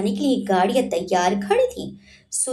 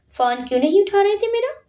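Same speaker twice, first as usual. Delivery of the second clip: shouting at him; 10 semitones higher, faster than usual. Acted. Sanjana yelling at Vansh for not picking up, fast and high. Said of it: "वंश पर चिल्ला उठी".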